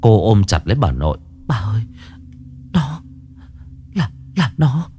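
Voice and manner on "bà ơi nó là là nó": rising terrified high cry; panicked girl. A narrator's reading. Xuân warning her grandmother about the monster, panicked and high. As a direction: gasp out the warning in terror. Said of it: "Xuân hoảng loạn".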